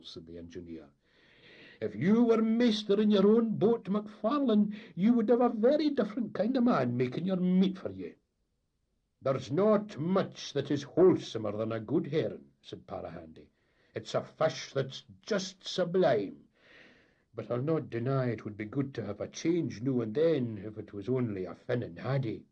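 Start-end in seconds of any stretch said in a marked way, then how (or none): 1.8-8.1 high, loud scolding; heated engineer's jab. Acted. Engineer taunting captain. 9.2-22.5 a hint of a fond old skipper; lightly voiced Scots captain. Parahandy speaks.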